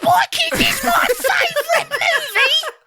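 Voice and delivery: very high, slow screechy British caricature; British accent. Pretend. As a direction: shriek it in a hammed-up British accent. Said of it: "Can you guys do a British accent?".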